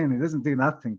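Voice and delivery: normal talking voice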